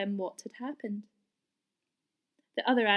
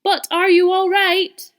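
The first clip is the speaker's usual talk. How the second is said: higher, louder, slow call; calling down concerned; 9 semitones higher, 14 dB louder than usual. A narrator's reading. Percy calling anxiously down the well, loud. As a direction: call down with worry. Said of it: "asked Percy".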